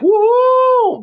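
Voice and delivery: high, loud little whoop; a quick imitated cheer of joy; mock cheer. A playful whoop to show he is not miserable. Mimics cheering about being happy.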